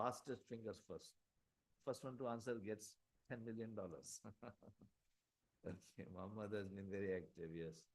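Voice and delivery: very faint, far from the mic; speaking too softly to be heard; barely audible. Real, not acted. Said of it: "your voice is low".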